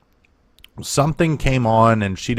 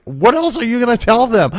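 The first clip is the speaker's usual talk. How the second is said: upset sister; 12 semitones higher, 4 dB louder than usual. Retold or imitated. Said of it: "she's probably getting upset".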